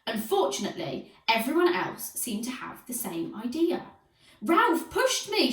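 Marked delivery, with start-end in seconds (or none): 4.4-5.5 high, slow shriek; high, drawn-out complaining shriek. A narrator's reading. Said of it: "shrieked Aerobic Al".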